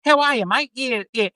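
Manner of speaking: high-pitched broad rural Aussie; broad Australian accent. Shown as a demonstration. Showing how country people greet a mate, broad Australian accent. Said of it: "it's like a broad Australian accent".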